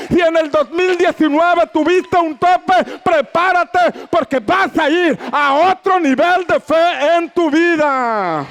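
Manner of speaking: high, rising sermon voice building to shouts; full-force preaching. Acted. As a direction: preach it out to the whole church, slow and rousing. (predicación a todo pulmón, alta y enfática)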